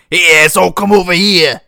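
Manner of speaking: loud, high tough-guy call; crab mimicking a voice. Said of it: "They're like".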